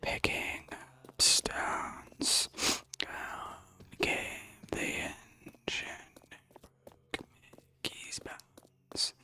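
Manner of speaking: sussurro sem voz, arrastado; whispered eerily, very slow. Pretend. Whispered rhyme recital.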